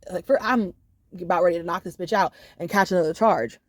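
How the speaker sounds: usual speaking voice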